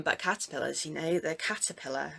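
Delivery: normal talking voice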